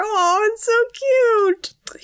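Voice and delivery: high, slow, gushing coo. Real, not acted. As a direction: swoon over how cute it is. Gushing over the coach.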